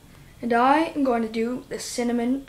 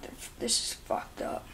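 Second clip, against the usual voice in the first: stammering voiceless whisper; barely-there strained whisper; choked whisper; 14 dB quieter than usual. Real, not acted. After taking the cinnamon.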